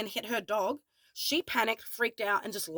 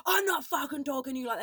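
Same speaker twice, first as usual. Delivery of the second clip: fast, higher-pitched mock rant; screaming and swearing; 5 semitones higher, faster than usual. Angry man swearing back at the woman who challenged him, mock screaming. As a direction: imitate an angry man swearing, without full volume. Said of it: "he's, like, screaming and swearing".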